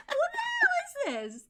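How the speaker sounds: very high, squeaky mock outrage; mock disbelief. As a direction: squeal it in hammed-up shock. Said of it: "You're like".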